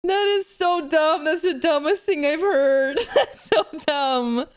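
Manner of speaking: slow, drawn out through laughter; real laughing. Real, not acted. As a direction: talk helplessly through laughing. Cracking up at a silly joke.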